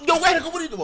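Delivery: high, loud shocked shout from classmates; retold yell. Classmates yelling at him.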